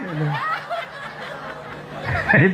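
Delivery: high-pitched breathy laugh; giggling high and soft. Real, not acted. Preacher laughs at own joke.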